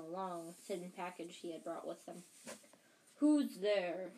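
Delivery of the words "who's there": loud, challenging shout. A narrator's reading. Uncle Vernon challenges whoever is knocking, shouted. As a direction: call out a loud challenge to an intruder. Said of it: "he shouted".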